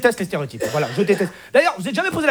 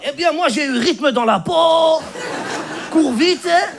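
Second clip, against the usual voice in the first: higher voice with stretched-out boasting; proud prize winner; 3 semitones higher, slower than usual. Acted. Mamadou lists his prizes to a friend, drawn out and proud. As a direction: play a winner slowly reeling off his awards. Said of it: "Eh, Mamadou".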